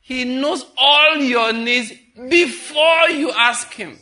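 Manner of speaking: very slow and loud, "before" drawn out; stress on before. Acted. Preacher driving home the verse to the church: slow, loud. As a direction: hammer every word home, leaning on "before". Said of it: "You know what Jesus said?".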